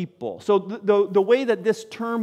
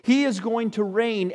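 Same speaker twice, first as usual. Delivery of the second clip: usual speaking voice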